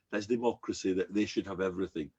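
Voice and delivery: ordinary talk, nothing marked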